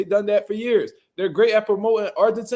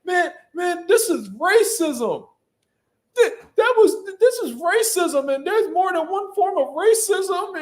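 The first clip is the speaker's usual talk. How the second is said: much higher, whiny mock voice, slowing down on the racism lines; mocking Teofimo Lopez; 10 semitones higher than usual. Mocking a boxer turned away from the press conference, high whiny voice. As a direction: squeak it out like a sore loser crying foul. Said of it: "He was like".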